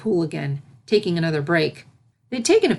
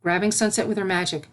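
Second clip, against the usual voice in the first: ordinary delivery, nothing marked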